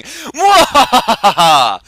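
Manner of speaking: slow booming evil cackle; loud drawn-out mad scientist laugh; villain laugh. Acted. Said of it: "Does that sound like me".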